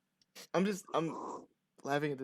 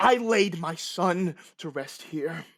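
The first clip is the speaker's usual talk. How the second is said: slow, raised, insistent declaration; indignant grieving father; 5 semitones higher, 6 dB louder, slower than usual. Acted. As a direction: insist on it, slowly and loudly. Farmer insists on son's burial.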